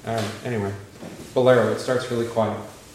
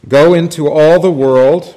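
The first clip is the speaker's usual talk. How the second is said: slow, much louder and higher; proclaiming scripture; 6 semitones higher, 12 dB louder, slower than usual. Acted. Teacher booms Mark's command to the class, slow proclamation. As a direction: call it out like a preacher.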